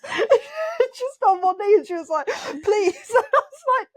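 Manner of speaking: high-pitched laughing talk, words breaking up; can't hold it together while telling it. Real, not acted. Recalling refusing the proposal, laughing hard.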